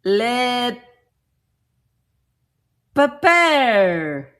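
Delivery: emphatic mock-French, last syllable drawn out; French accent. Reading the French label to viewers, French accent. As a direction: announce LE PEPPER with a loud French flourish. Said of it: "They're in French".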